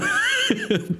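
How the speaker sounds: shrill giggle burst; very high squeaky laugh. Real, not acted. Laughing at the failed roll.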